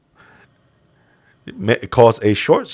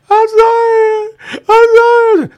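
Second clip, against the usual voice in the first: high, loud sobbing plea; crying apology; 8 dB louder, slower than usual. Retold or imitated. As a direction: beg for mercy in tears. Beaten fighter begging.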